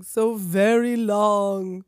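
slow, drawn-out words of a waking monster; sleepy monster. Acted. Monster waking in his casket, slow and drawn out. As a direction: stretch it out like a long-slept creature.

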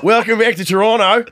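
hearty imitation of an Australian welcome; Adam Demos impression. Imitating Adam phoning his friend, Australian accent. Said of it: "This is an excellent accent".

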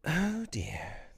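high, breathed exclamation; remorseful. A narrator's reading. Wendy realising what she has done, remorseful. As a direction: sigh it out with a twinge of regret. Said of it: "with her first real twinge of remorse".

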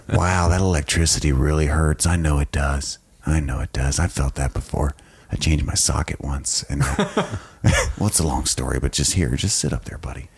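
low, confiding good-cop murmur. Acted. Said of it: "he's like, good cop".

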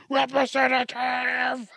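loud, high, slow shout; drawn-out shout. Pretend. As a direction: bellow it into the phone. Caller yelling for human operator.

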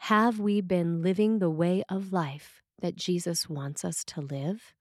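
ordinary delivery

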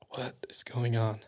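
hushed, baffled retold reaction; barely voiced, soft bewilderment; stunned whisper. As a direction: whisper it in disbelief. Retold husband finds the ring, stunned.

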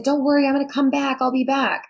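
high, quick imitation of a reassuring kid; retold reassuring promise. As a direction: retell a hurried promise, pitched well up. Retold childhood promise to return.

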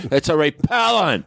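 joking shout. Real, not acted. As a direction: shout it as a joke. Joking shout about cologne.

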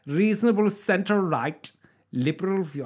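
a put-on German accent, starting to slip. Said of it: "read that in German accent".

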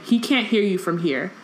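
normal talking voice

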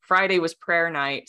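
normal talking voice

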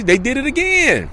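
high-pitched, drawn-out mock exclamation; mock outcry. Podcaster exposing an empty apology, in a high mock outcry. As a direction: pitch it way up and stretch the last word. Mock outrage at repeat offense.

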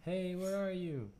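high, drawn-out searching call; slow singsong pretend holler; mock calling out. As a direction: call out playfully for a lost friend. Calling out to lost drunk friend.

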